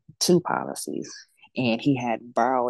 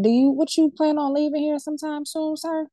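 high, mock-shocked question to her father; a retold high incredulous challenge. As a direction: act amazed he is planning his own burial. Incredulous daughter questions dying dad.

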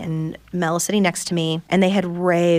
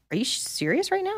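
higher, soft, disbelieving question; stunned disbelief; 13 semitones higher, 6 dB quieter than usual. Retold or imitated. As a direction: let the question float up in shock. Friend stunned by news.

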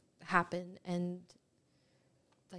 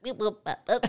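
Minion voice; 5 dB louder than usual. Pretend. Minion noises.

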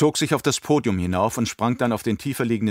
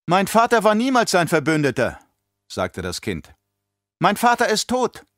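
put-on high childlike voice, cold and defiant; a child sneering at the old monster; defiant little girl; 11 semitones higher, 4 dB louder than usual. A narrator's reading. Alia answers the emperor, defiant in a girl's voice.

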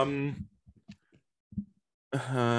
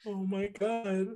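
shocked; 9 semitones higher, 5 dB quieter, slower than usual. Real, not acted. Shocked reaction.

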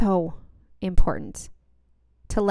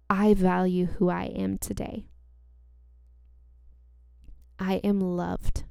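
slow affirmations; slower than usual. Shown as a demonstration. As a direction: speak each line slowly and gently. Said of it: "So repeat after me".

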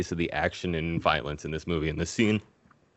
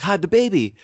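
higher, louder urgent order; urgent mother; 10 semitones higher, 6 dB louder than usual. Retold or imitated. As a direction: snap it out like a panicked mother. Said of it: "And then she's like".

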